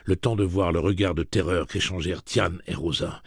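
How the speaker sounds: ordinary delivery, nothing marked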